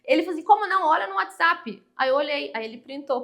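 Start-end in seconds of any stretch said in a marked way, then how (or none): 0.5-1.8 insistent disbelief. Retold or imitated. Caller insists she passed.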